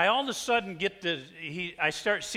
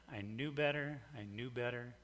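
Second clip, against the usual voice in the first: fading; 6 semitones lower, 12 dB quieter than usual. Shown as a demonstration.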